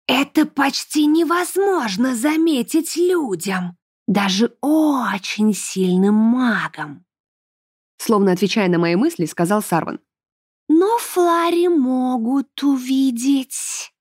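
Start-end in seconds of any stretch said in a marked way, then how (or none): 0.1-7.0 slow, high, otherworldly elf voice; a drawn-out voice of a fey being; Sarvan's voice. Acted. Sarvan explains. 10.7-13.9 slow, high, otherworldly elf voice; a drawn-out voice of a fey being; Sarvan's voice. Acted. Sarvan continues.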